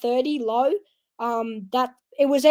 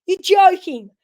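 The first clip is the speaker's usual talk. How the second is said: loud, higher, stunned disbelief; shocked disbelief; 6 semitones higher, 8 dB louder than usual. Retold or imitated. Boy hearing the champion is in his pool, loud stunned disbelief. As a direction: blurt it out, amazed it's really her. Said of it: "And I'm like".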